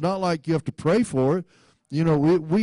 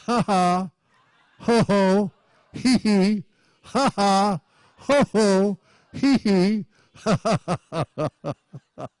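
deliberate laughing; 3 semitones higher than usual. Shown as a demonstration. Preacher releasing joy by laughing, deliberate laughing. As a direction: laugh syllables breaking into a run of ha-has. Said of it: "We need to laugh".

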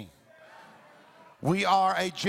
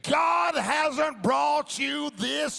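forceful preaching; 6 semitones higher than usual. Real, not acted. Pastor urging people to share worship and sermons, forceful preaching. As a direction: urge the church on with conviction. Preaching rises after calm talk.

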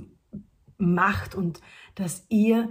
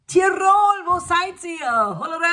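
calling out; 10 semitones higher, 6 dB louder than usual. Real, not acted. Calling out to missing Tirol.

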